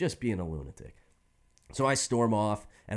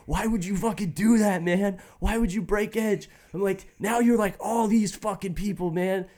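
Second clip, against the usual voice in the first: higher, crying teen rant, hammed up; tearful rant; 9 semitones higher than usual. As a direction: replay the tearful teenage outburst, pitched up. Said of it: "So I'm crying".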